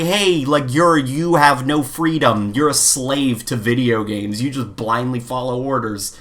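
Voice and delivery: ordinary talk, nothing marked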